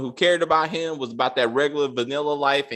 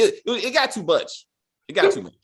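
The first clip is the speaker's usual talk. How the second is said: exasperated outburst; 7 semitones higher than usual. Real, not acted. Overwhelmed by the scene.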